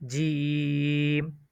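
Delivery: জিম with the vowel held long and level; জিম, drawn out three measures, very slow; three-alif stretch. Shown as a demonstration. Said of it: "তিন আলিফ টান হবে". (জিম তিন আলিফ টেনে দীর্ঘ উচ্চারণ)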